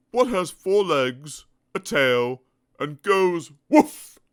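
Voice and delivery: slow, loud teasing question; smug elephant. Acted. Elephant teasing Mister Dizzy with a riddle. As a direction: lay the trap slowly.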